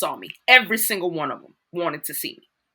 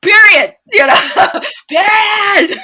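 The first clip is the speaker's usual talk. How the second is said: loud high "period", laughing; loud emphatic "period"; 14 semitones higher, 14 dB louder, slower than usual. Real, not acted. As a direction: shout it out as the final word. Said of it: "like, period".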